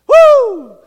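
laughing, very loud, high whoop of joy; joyful shout. Real, not acted. Preacher overjoyed by the revival letter, loud whooping shout. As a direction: let out a real celebratory woo. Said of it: "I'm about to start shouting".

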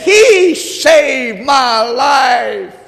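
drawn-out grateful shout; slow shout. Acted. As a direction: stretch each word out, loud. Widow's final cry.

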